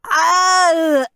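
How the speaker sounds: high, loud crying scream. A narrator's reading. Steve is splattered with goo and screams. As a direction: shriek as the goo hits. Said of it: "screamed Steve".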